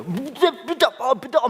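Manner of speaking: very high, mock-frantic sputter; mimicked angry outburst. Mimicking the girl's heated outburst. (높은 목소리로 흥분한 말투 흉내)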